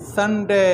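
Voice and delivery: संडे with a drawn-out diphthong on डे; careful pronunciation. Shown as a demonstration. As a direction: stretch the डे of संडे. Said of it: "सुनिए please".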